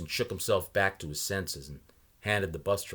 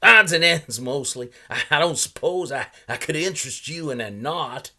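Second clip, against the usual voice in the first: put-on a higher, louder voice for the odd old salesman; old man pitching his wares, higher and louder; 8 semitones higher, 12 dB louder than usual. A narrator's reading. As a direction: play the chatty old knot peddler. Salesman's voice in read story.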